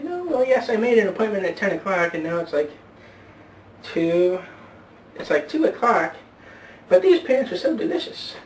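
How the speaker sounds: put-on high old lady voice, rising on delicious; old woman voice.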